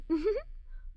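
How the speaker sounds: soft high giggle; quiet childlike giggle; giggling. Acted. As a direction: let a little giggle slip. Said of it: "I started giggling".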